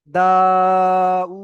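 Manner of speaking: দা and উ each held long; stretched vowels. Shown as a demonstration. Teacher modelling the word slowly, long দা and উ. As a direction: draw out দা, then draw out উ. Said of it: "এখানে টান হবে না".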